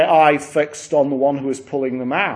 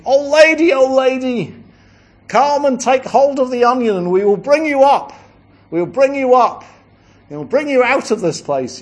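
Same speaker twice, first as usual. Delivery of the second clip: high, loud calling voice; angels calling down; 10 semitones higher, 6 dB louder than usual. Acted. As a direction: call down into the pit to her.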